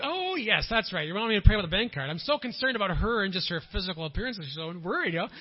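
loud, high, fast hammed-up exclamation; mocking yourself for missing her point; mock realization. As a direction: send up your own pious worry. Mock self-righteous reply mid prayer.

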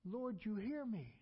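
normal talking voice

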